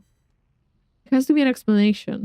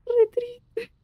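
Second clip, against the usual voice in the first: tearful; 13 semitones higher than usual. Real, not acted. Moved by a sad game scene.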